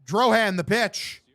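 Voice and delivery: live baseball commentary; punchy sportscaster delivery; play-by-play. Acted. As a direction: call the pitch like a radio announcer.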